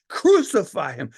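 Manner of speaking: loud mob cry; crowd cheer. Acted. The crowd roars for crucifixion: loud cry. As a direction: shout it over the cheering crowd. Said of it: "cheering and cheering".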